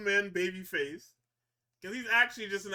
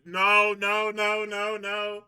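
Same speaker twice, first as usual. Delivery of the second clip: shouting no; 3 semitones higher, 10 dB louder, much slower than usual. Retold or imitated. Voiced refusal shouted.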